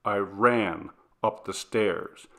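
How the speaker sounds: ordinary delivery, nothing marked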